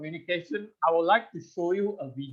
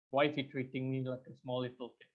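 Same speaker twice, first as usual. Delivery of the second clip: softer and lower, quick muttered repeat; muttered to self; 6 semitones lower, 10 dB quieter, faster than usual. Retold or imitated. Asking himself again about the condescending speaker, muttered. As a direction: mutter it under your breath.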